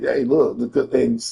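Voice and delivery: ordinary delivery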